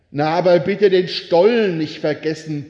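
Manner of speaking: ordinary talk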